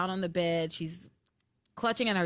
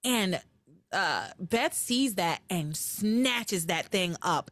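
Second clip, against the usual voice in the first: usual speaking voice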